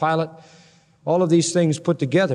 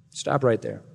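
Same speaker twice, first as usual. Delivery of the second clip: ordinary talk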